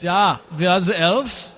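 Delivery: slow, put-on German accent; deliberate mock German villain accent. Shown as a demonstration. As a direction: show off a hammed-up German accent. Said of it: "a better German accent".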